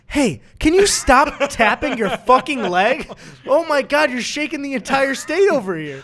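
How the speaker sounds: high, loud hammed-up complaint; mock outraged yelling. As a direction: yell down the phone in absurd indignation. Acted earthquake phone-call complaint.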